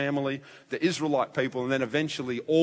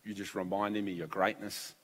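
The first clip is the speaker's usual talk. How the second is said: soft, lowered voice in worship; prayerful wonder; 3 semitones lower, 5 dB quieter than usual. Retold or imitated. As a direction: quietly confess to God in awe. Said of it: "I worship God along with you".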